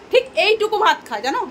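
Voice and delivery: high, loud exasperated emphasis on ঠিক; emphatic complaint. Real, not acted. Complaining daughter eats little. (উঁচু গলায় জোর দিয়ে নালিশ)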